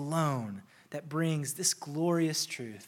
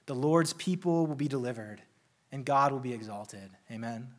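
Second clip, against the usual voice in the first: usual speaking voice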